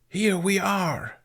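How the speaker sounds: slow, drawn-out put-on gruff voice; gruffer yell. A narrator's reading. Tooth voices the hidden accusers, yelling gruffly. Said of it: "she yelled in a gruffer voice".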